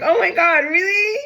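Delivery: high, teary, breaking with joy; voicing someone near happy tears, pitched high; overjoyed disbelief. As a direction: gasp it out as if you can't believe it. Grateful partner voiced in reading.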